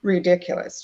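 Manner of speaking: acting out an impatient retort; exasperated. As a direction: push back in mild, high-pitched disbelief. Acted exasperated reply to receptionist.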